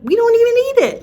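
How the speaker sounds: high, loud, quick squeak; exasperated protest. Real, not acted. Telling viewers the bad food goes uneaten, squeaky protest. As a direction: pitch it way up in protest.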